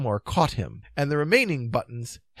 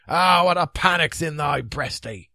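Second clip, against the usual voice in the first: loud, broad Scots recitation; Scottish drawbridge man; 5 semitones higher, 7 dB louder than usual. A narrator's reading. Drawbridge man commenting on their fright, broad Scots. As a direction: exclaim it in Scottish dialect. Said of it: "said the drawbridge man".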